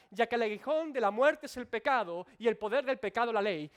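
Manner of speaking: lectura bíblica proclamada, alta y fuerte; predicación triunfal. Acted. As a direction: proclama el versículo con toda la fuerza. Recita la Escritura predicando con fuerza. (proclamación triunfante desde el púlpito)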